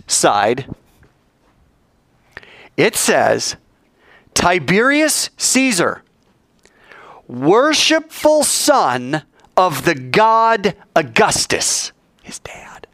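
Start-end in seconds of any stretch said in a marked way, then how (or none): 4.3-11.9 each word drawn out like a proclamation; slow solemn reading. Shown as a demonstration. Preacher reading the coin's inscription to the congregation, slow and grand. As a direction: read the inscription slowly, grandly. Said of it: "This coin says".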